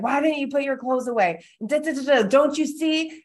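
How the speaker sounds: mock yelling. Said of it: "someone yelling at you".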